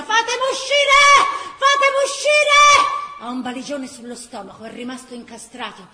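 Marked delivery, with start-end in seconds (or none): 0.0-3.0 trapped man yelling, high and very loud; desperate high shout, louder the second time; man screaming. Acted. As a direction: scream to be let out, building. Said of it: "un uomo urla".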